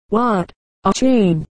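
understated storybook astonishment; crying out, mildly. A narrator's reading. Wolf learns the dog is chained, cries out in mild surprise. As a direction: question it with a touch of disbelief. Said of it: "cried the wolf".

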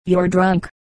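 ordinary delivery, nothing marked

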